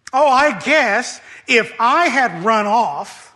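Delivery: elder son bitterly mocking his father; bitter sarcasm. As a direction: sneer out years of grievance. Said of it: "dripping with venom ... disgust and long-held bitterness".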